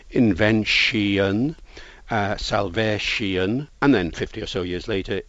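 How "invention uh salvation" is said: "invention" and "salvation" drawn out slowly, the -tion ending stretched; slow older English ending on "invention" and "salvation"; older pronunciation. Shown as a demonstration. Linguist showing how words ending in -tion once sounded, older pronunciation of "invention" and "salvation". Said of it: "become close to the modern pronunciation".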